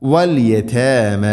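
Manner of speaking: വൽ യതാമ slow, with thin fronted "tae-mae"; light ta. Shown as a demonstration. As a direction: recite യതാമ with light, fronted long vowels. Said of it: "ലൈറ്റായിട്ട് ഉച്ചരിക്കണം".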